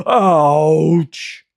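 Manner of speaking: loud, aggrieved lion yelp; a hurt big cat's complaint; lion complaining. A narrator's reading. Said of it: "the lion complained".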